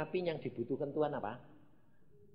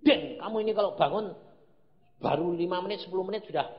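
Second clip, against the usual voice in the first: loud, higher-pitched parental telling-off; retold scolding; 4 semitones higher, 6 dB louder, faster than usual. Father scolds son Denny for dozing in prayer, retold scolding. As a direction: scold the child in mock exasperation. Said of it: "pernah marah sama anak saya".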